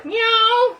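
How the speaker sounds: high, loud mock meow; cat meow. Imitating the cat.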